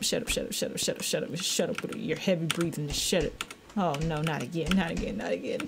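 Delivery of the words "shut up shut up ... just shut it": scared hushing. Real, not acted. Hissing at breathing sound in game.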